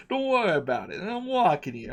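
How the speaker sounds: lightly hammed New York street talk; a wiseguy from Brooklyn, hammed up; New York accent. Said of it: "I'm accenting here".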